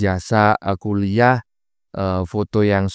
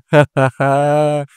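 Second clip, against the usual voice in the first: loud, higher-pitched taunting laugh; 5 semitones higher, 5 dB louder than usual. Real, not acted. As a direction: laugh at their cowardice. Taunting others for being afraid.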